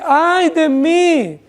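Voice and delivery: high, long-drawn cry of woe; psalmist's lament. Acted. Preacher quotes the psalmist living among the impious, lamenting. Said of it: "Y el salmista dice".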